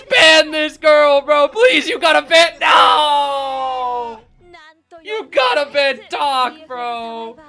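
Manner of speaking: loud, high, slow begging shout; loud pleading. Real, not acted. Streamer loudly begging viewers to ban Doc, slow and high. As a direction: drag out the plea to ban her.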